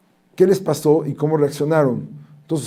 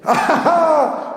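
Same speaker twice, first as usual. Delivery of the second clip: high laugh; 11 semitones higher than usual. Acted. Akiva laughs before answering.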